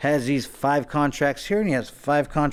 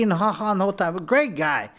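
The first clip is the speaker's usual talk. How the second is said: ordinary talk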